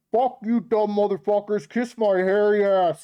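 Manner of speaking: loud, high furious yelling from the other room; imagined angry wife. Pretend. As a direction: scream it at the screen like the fed-up wife next door. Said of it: "being like".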